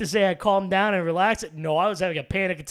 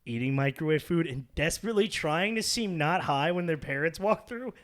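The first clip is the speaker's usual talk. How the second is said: ordinary talk, nothing marked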